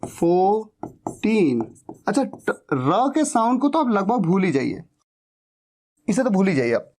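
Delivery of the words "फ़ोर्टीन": put-on फ़ोर्टीन slowly as "foh... teen"; silent r. Shown as a demonstration. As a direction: split फ़ोर्टीन into two long syllables, r silent. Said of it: "ये है फ़ोर्टीन".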